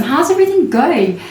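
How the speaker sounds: high chirpy how's everything going; bright greeting. Retold or imitated. Mimics a cheerful greeter.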